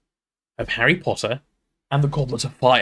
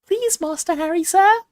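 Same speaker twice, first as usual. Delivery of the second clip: much higher, squeaky house-elf pleading; Dobby voice. Shown as a demonstration. Reader shows chat the easy Dobby voice, high and squeaky. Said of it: "Dobby voice, easy".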